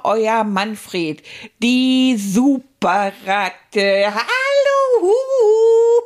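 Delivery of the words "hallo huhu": squeaky excited hello with a giggle; gleeful high greeting. Acted. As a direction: burst out giddy and high. Puppet greets kids gleefully.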